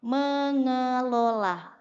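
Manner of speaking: "Mengelola" spoken very slowly, each syllable stretched; slow emphatic repeat. Shown as a demonstration. Teacher repeating the answer slowly for the audience. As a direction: drill the word "Mengelola" slowly.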